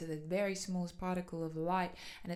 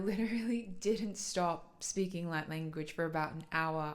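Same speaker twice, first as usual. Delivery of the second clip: ordinary delivery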